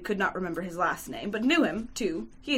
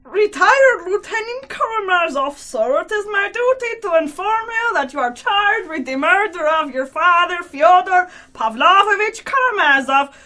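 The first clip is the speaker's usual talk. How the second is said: slow, loud, raised official pronouncement; firm, loud, grave declaration; 7 semitones higher, 8 dB louder than usual. Acted. Short attorney formally charges Mitya with murder, loud and grave. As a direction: declare the charge with solemn weight. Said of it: "firmly, loudly, and gravely declared".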